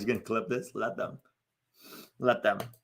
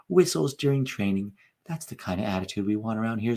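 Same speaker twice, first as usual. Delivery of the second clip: Greg. Acted. Coach answering the star striker about team attitude, mock-gentle. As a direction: gently chide the star player, keeping it mild. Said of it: "Zlatan would never play for Greg".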